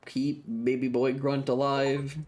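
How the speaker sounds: usual speaking voice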